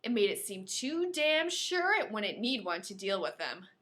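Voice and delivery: normal talking voice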